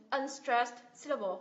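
ordinary talk

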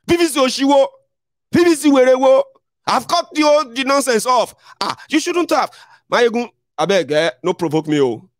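mock the friend dismissing his voter card; scornful friend.

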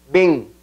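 bien said as beŋ with a nasal e, m almost lost; nasal bem. Shown as a demonstration. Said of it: "nasal esa E". (e nasal en bien)

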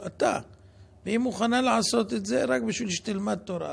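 ordinary delivery

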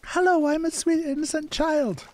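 put-on high-pitched, sweet innocent kid; child voice. Shown as a demonstration. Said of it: "Shall I keep doing my child voice?".

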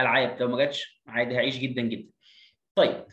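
ordinary talk

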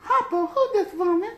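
high laughing woman's call. Retold or imitated. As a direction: squeal the question high. Quoting movie line.

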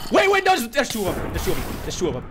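high, loud alarmed cry; panicked shout. Real, not acted. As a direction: yell out in sudden panic. Sudden second enemy panic.

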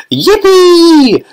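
high, loud whoop of joy; an old man whooping like a boy; joyful shout. A narrator's reading. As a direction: Grandpa Joe whooping as the lift shoots up. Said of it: "shouted Grandpa Joe".

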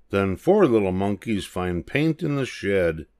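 plain reading voice

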